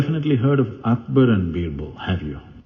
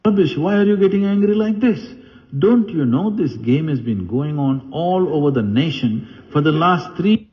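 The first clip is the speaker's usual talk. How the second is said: higher, brisk queen brushing off her husband's anger; the queen voice, higher and quick, as if he's being silly; higher, lightly mocking queen putting her emperor in his place; queen scolding; 7 semitones higher than usual. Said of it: "The queen said".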